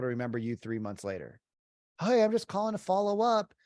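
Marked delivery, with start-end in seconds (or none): 2.0-3.5 mock cheery caller, much higher pitch; hammed-up eager cold call, pitched way up; chirpy sales caller. Agent mocking the follow-up call: high chirpy sales voice. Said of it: "It's the F phrase".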